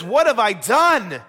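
loud, drawn-out cry of protest; acted anguish, each word stretched; anguished David. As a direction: plead it loudly and slowly. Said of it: "you can hear the anguish in his voice".

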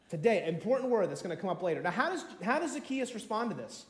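ordinary delivery

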